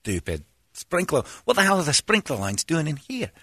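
put-on Scottish self-talk, grumbling; imitation of cursing to himself in Scottish accent. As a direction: scold yourself in a mock Scottish accent. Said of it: "it's Scottish".

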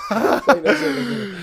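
cracking up while trying to talk; laughing. Real, not acted. Cracking up, telling co-host not to.

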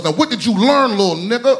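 menacing grandma raising her voice. Retold or imitated. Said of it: "And she goes".

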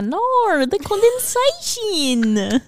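slow, high, muffled underwater voice. Retold or imitated. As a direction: gurgle it as if underwater. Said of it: "imitating the clip".

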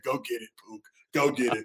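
quiet, low urging to a teammate; quiet egging on of a teammate; soft egging on. Retold or imitated. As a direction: murmur encouragement under your breath. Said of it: "And I said".

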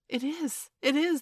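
put-on high, excited chorus of children; all crying out. A narrator's reading. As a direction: insist eagerly, pitch way up. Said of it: "they all cried".

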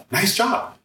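sarcastic "Nice job" with a high rising "job"; mock the praise on "Nice job", lilting up. Shown as a demonstration. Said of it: "different ways you can interpret it".

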